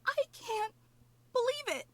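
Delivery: high, thrilled gasp of joy; overjoyed. Acted. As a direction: greet your hero with giddy delight. Fantina overjoyed to see hero.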